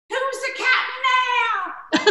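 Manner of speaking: cheeky pop star gloating at Tom Hanks; mock [name]. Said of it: "And he goes".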